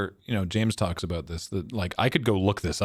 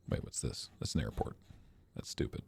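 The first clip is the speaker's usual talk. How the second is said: muttered aside; 11 semitones lower, 13 dB quieter than usual. Real, not acted. Muttering to himself over the map.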